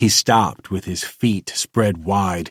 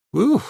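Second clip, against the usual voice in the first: relieved whew; 4 dB louder than usual. A narrator's reading. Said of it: "Rick breathed".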